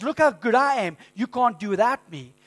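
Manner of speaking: loud, high, mocking the proud; full-force preaching. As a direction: thunder it from the pulpit. Preaching pride's words.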